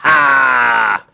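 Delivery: loud, high pirate "Arr"; pirate shout. Pretend. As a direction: bellow it like a pirate. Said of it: "In my best pirate voice ever".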